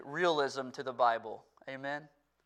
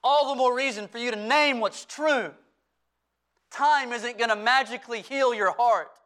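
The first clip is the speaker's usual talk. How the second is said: raised, louder preaching voice, pitched up; full-force preaching; 9 semitones higher, 7 dB louder than usual. Acted. Pastor urging his church to bring their past to Christ, full-force preaching. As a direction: preach it out to the whole room with passion.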